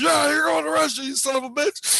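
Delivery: high, hammed-up furious yell; acted angry shout. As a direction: shout it like a fed-up captor. Acted angry captor's answer.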